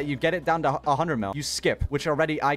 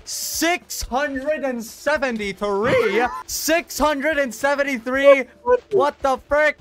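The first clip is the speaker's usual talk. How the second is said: high, slow, drawn-out disbelief; astonished shout; 11 semitones higher, 5 dB louder, much slower than usual. Real, not acted. Gamer reads out his huge ferocity, astonished. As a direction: stretch out every part of the number, amazed.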